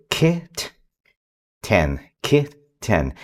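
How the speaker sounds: kit and ten said slowly, apart; slow syllable blending. Shown as a demonstration. Parent joining two chunks for a child, slowly. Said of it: "we start kind of slow".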